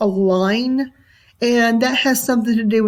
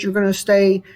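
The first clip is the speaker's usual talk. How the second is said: ordinary delivery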